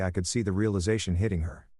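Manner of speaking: plain reading voice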